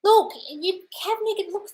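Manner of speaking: usual speaking voice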